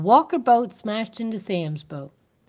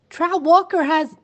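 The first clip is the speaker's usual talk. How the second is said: higher, louder urgent outburst; 10 semitones higher, 7 dB louder than usual. Acted. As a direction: blurt it out, then get cut off. Urgent warning, cut off.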